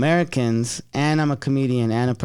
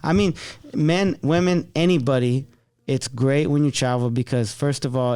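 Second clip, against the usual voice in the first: ordinary talk, nothing marked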